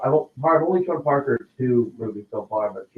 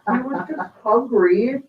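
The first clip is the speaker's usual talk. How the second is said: imitating little daughter; 10 semitones higher, 4 dB louder, slower than usual. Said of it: "She goes".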